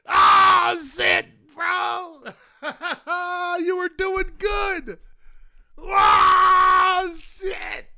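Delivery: high, loud hollering through laughter; shocked, laughing outburst. Real, not acted. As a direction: holler in amused disbelief.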